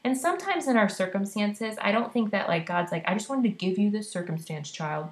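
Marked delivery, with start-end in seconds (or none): none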